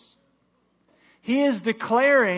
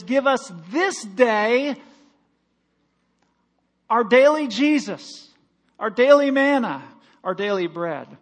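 preaching at full force; 4 semitones higher, 6 dB louder than usual. Acted. Pastor to congregation, preaching the daily bread line loud. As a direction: stretch out the prayer and swell on daily.

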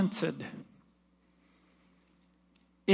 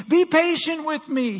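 begging servant; 8 semitones higher, 4 dB louder than usual. Acted. Servant on his knees begs master, high pleading. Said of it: "he begged".

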